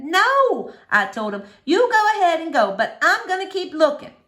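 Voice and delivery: put-on girl defying her father in the rain; defiant girl. A narrator's reading. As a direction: dig in and refuse to go home. Girl defies the preacher.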